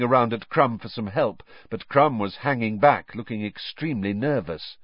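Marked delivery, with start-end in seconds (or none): none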